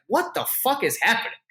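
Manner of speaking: high-pitched mock astonishment; retold disbelief. Everyone there reacting to the hug, imitated disbelief. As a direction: imitate a baffled onlooker.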